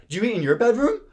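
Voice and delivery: high-pitched mock scolding; Dorinda blasting them. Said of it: "storms down and blast their asses".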